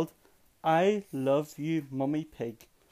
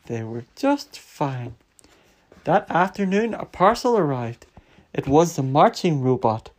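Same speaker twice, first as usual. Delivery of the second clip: plain reading voice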